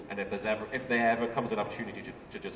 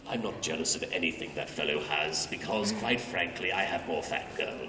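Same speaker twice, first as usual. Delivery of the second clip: Bakura voice; 7 semitones lower than usual. Acted. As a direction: answer as the smug Bakura, sneering. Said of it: "Is Bakura jealous".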